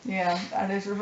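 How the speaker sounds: normal talking voice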